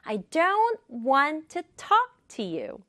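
"don't", "want", "talk" stressed, small words light; rhythmic stress. Shown as a demonstration. Teacher demonstrating rhythm, stress on "don't", "want", "talk". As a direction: punch "don't", "want", "talk" in a slow beat. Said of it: "이렇게 리듬을 살려서 말하면".

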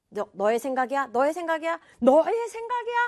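repeated question climbing in pitch; interrogating. Acted. Brother grilling someone about the idea, interrogating. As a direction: press harder on each 너의. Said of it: "추궁을 하고 싶으면".

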